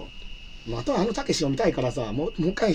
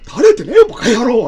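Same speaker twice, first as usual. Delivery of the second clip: loud, rough Takeshi-style retort, pitched much higher; Takeshi impression; 6 semitones higher, 9 dB louder than usual. Father-in-law snapping at his son-in-law in a loud Takeshi voice. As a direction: bark it back like Beat Takeshi. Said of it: "両方たけしなってて".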